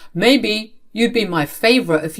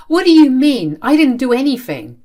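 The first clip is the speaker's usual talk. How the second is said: much louder, higher disbelief; shocked protest; 7 semitones higher, 7 dB louder than usual. Acted. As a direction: cry out in disbelief. Daughter shocked by accusation.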